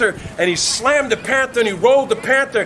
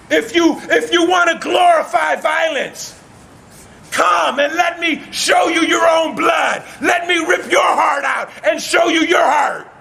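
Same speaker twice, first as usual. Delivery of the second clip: high, fierce declaiming; ringing challenge, pitched way up; shouted threat; 6 semitones higher than usual. Acted. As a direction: threaten a whole arena. Beast threatens the Roman crowd.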